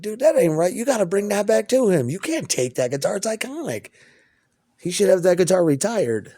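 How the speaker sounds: high, loud, worked-up objection; acted indignation, pitch jumping up; indignant protest. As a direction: protest as if talking a friend out of it. Imagined protest to guitar's new owner.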